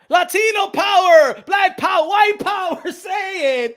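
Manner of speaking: high, loud slogan chant; rallying shout. Acted. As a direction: shout it like a hammed-up rally cry. Chanting pride slogans to urge others.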